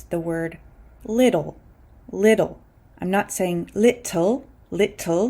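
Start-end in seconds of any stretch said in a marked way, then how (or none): 1.0-2.6 "little" said like "liddle"; fast D for T. Shown as a demonstration. Teacher models American "little" with a fast D. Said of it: "pronouncing this T as a fast D". 3.7-5.3 "little" with a crisp British T; British-style T. Shown as a demonstration. Teacher contrasts how a British person says "little", crisp British T. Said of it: "the way a British person might say it".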